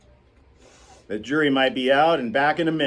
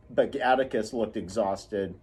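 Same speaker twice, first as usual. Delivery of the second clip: ordinary delivery, nothing marked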